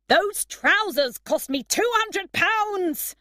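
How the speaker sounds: high, loud and slow, indignant; outraged shout. A narrator's reading. Dexter shouting at Emma about his stolen trousers, outraged. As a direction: bellow it in outrage at the loss. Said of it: "he shouted at Emma".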